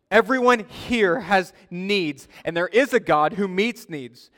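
loud, emphatic pulpit delivery; forceful preaching. Real, not acted. Pastor warns congregation against their own strength, forceful preaching. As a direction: drive it home to the congregation, loudly.

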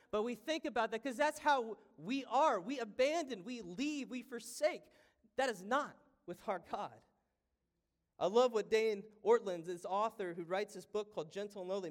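fervent pulpit delivery; impassioned preaching. Acted. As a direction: drive it home to the congregation. Preaching builds to full force.

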